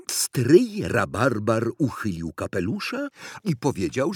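plain reading voice

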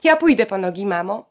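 loud, urgent offer; cried out. A narrator's reading. Bronka crying out to her mother to stop the fight, loud. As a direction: blurt it out to stop the quarrel. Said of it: "Bronka krzyknęła".

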